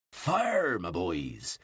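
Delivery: cheerful commander's shout; rallying shout. A narrator's reading. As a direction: call the shot like an artillery officer. Firing the catapult, calling out.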